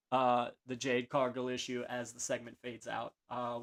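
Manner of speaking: ordinary talk, nothing marked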